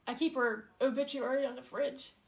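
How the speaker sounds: real grief kept in check; emotional. Real, not acted. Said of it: "I'm trying not to get emotional".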